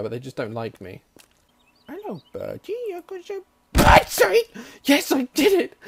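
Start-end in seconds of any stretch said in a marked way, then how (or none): 1.9-5.8 squeaky high bird-luring voice; high coaxing call. Pretend. Gamer luring a bird in a high squeaky voice. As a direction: lure the bird, then squeal in triumph.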